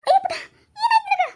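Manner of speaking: high, loud cry of concern; alarmed parent. Acted. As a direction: call out in alarm to a distressed child. Alarmed parent rushing to crying daughter.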